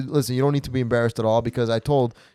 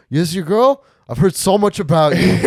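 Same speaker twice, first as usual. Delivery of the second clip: higher, louder gushing hello; mock overeager greeting; 7 semitones higher, 7 dB louder than usual. Mimicking Tyler greeting the girlfriend, gushing. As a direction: ham up the too-eager friend. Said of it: "you look at her and you go".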